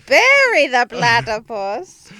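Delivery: mock dramatic reveal. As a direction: stretch it out like a cartoon villain's outraged cry.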